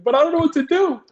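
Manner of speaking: high, whiny plea of a scared rookie; panicked whine. Retold or imitated. Rookie cop pleading with his training sergeant, high panicked whine. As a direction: squeak it out like a helpless new cop. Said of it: "And I'm like".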